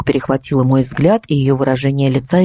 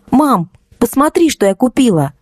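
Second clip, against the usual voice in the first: put-on high, bright-eyed young daughter showing off a purchase; excited daughter; 8 semitones higher than usual. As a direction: call out to mum in a high voice. Дочь радостно зовёт маму. (радостный голос дочери)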